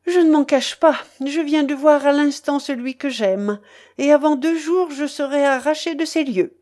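loud, high, proud avowal; defiant declaration. A narrator's reading. Young woman openly tells the pirate of her lover, defiant.

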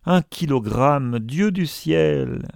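high outcry, dragged out at the end; exasperated exclamation. A narrator's reading. Professor exasperated by the miser. (exclamation exaspérée)

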